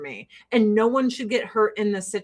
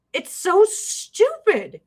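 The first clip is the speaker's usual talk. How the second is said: normal talking voice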